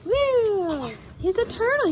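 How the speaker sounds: ordinary delivery, nothing marked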